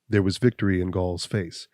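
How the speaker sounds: plain reading voice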